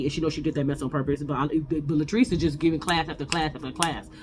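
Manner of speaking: ordinary talk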